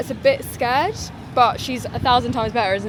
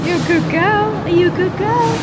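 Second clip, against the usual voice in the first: higher and louder, bright praise; puppy praise; 8 semitones higher, 10 dB louder than usual. Real, not acted. Owner encourages her nervous puppy at the store, higher and louder. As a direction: cheer the nervous dog on with praise.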